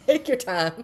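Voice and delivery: loud, high mock patience; loud teasing. Real, not acted. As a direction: shout it teasingly. Teasing friend repeats loudly.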